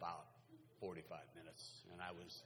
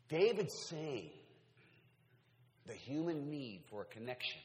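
full force preaching; 5 semitones higher, 8 dB louder than usual. Real, not acted. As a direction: build and drive the point home.

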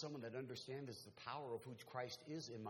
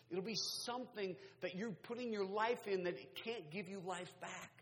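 high, driving sermon voice; impassioned preacher, pitch held high; forceful preaching; 9 semitones higher, 5 dB louder than usual. Real, not acted. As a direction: press the warning on the congregation.

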